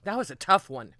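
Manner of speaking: ordinary delivery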